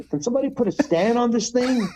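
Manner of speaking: high, exasperated call for help with the bike; fed-up rider nobody is listening to; annoyed plea. Retold or imitated. Rider left holding his bike in pit lane, high and exasperated.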